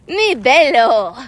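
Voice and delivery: slow, drawn-out minion "Mi bello.". Retold or imitated. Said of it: "He also says".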